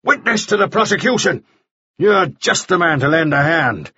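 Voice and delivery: high, forceful declaration; crying out. A narrator's reading. Mannering recruits Nielsen as witness, crying out. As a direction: proclaim it to the newcomer. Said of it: "he cried".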